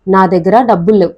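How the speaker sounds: ordinary delivery, nothing marked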